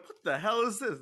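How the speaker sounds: incredulous reaction. Retold or imitated. Acted incredulous reaction to the film.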